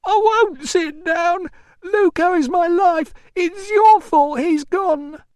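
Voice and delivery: high, loud, slow wail; hysterical defiance. Acted. Mother defying the man she blames, hysterical. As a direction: refuse and accuse at a high, loud pitch.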